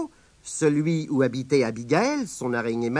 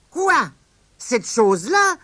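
put-on high astonished boy; boy staring at a giant hanky; mock surprise; 8 semitones higher than usual. Grandson to grandma, cheeky high surprise. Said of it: "s'écria Tirondin".

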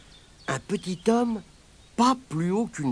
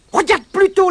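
very high, loud tricky voice; leprechaun's call; 10 dB louder, faster than usual. Acted. Leprechaun tries to distract Pat, very high and loud. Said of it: "lança-t-il".

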